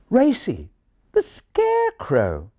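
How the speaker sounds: high, slow explanation to the ignorant; strained patience in refined English; a little tired. A narrator's reading. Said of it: "becoming a little tired of".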